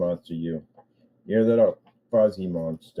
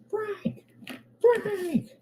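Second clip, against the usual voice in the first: calling out; 4 dB quieter than usual. Pretend. Calling out to Uncle Frank.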